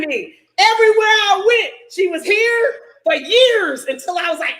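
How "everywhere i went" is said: normal talking voice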